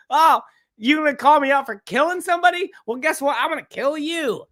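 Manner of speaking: hammed-up outraged comeback, pitched well up; mock angry threat. Host mimicking Alec Baldwin threatening the man calling him out, mock angry.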